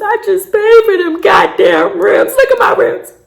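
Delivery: hammed-up high-pitched dismay; mock anguish. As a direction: wail about your precious rims. Re-enacts anguish over wrecked rims.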